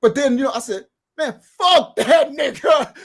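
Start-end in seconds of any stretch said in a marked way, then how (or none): 1.2-3.0 slow, loud laugh through it; retold defiant shout with a laugh. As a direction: laugh it off loudly.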